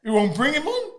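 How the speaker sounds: laughing, incredulous outburst; outraged disbelief. Retold or imitated. Boxer retelling his outraged answer to the manager. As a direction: retell the indignant reply half laughing.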